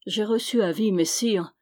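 plain reading voice

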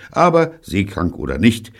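plain reading voice

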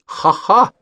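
high, loud mocking ha-ha. A narrator's reading. Pavlik mocks his brother, loud high ha-ha. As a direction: laugh at him like a brat. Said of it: "сказал Павлик".

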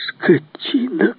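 high, drawn-out mock yell; angry cry. A narrator's reading. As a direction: cry out high and slow, without full volume. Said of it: "крикнул князь". (сдержанный гневный выкрик, высоко и протяжно)

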